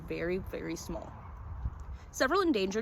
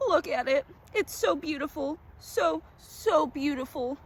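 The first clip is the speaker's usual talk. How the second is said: higher, very slow, rapt; obsessed awe; 8 semitones higher, slower than usual. A narrator's reading. Collector gazes at the orchid, slow and entranced. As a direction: linger on so beautiful like a man possessed.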